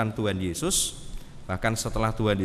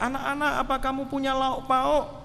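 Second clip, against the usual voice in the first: much higher voice calling across the beach; 14 semitones higher than usual. Retold or imitated. Jesus shouting from the beach to the disciples, high. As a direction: call to them on the water. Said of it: "Tuhan Yesus berteriak".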